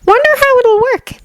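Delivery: put-on high, thoughtful girl's voice; pensive girl voice. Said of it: "Uraraka mutters pensive".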